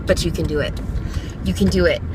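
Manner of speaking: low, soft encouragement; reassuring. Real, not acted. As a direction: reassure them quietly and sincerely. Reassures listeners after anxiety rant.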